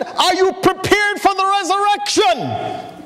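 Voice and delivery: a rookie preacher going for the big one; earnest young preacher. Retold or imitated. Young preacher to farmer, high and solemn.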